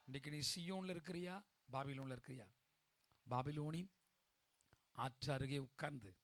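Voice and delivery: low, soft sermon voice; hushed preaching. Acted. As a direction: drop right down and confide it to the congregation.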